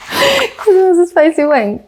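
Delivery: high, slow words through real laughter; laughing. Real, not acted. Host explains Lewis's blistering mouth joke, laughing. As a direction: squeak it out after cracking up.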